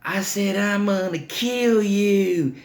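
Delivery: put-on high, drawn-out toddler wail; crying angry kid. Crying toddler repeats threat to babysitter, high mock wail. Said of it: "And he's crying".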